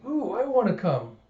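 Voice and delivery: put-on high, eager little-girl voice; eager kid voice. Anya asking to join the study group, high and eager. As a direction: beg to tag along.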